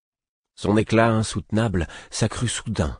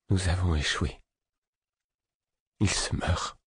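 quiet, hopeless voice sinking to a near whisper; ton désespéré; 5 dB quieter than usual. Acted. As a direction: say it like all hope is gone. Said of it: "d'un ton empreint de désespoir". (voix désespérée, presque chuchotée)

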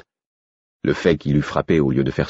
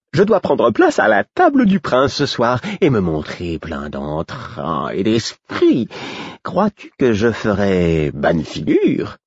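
higher, very slow grand jester voice; a jester preening, higher and very slow; vain fool; 5 semitones higher, slower than usual. Acted. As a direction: drag it out like a pompous jester. Fool preens before dinner.

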